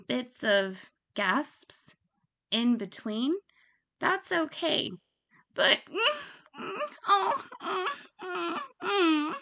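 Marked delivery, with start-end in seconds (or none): 5.9-9.3 exaggerated moaning. Shown as a demonstration. Mocking annoying moaning on calls, high hammed-up moans. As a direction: overdo the moans on purpose as a bad example. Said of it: "don't do that, please".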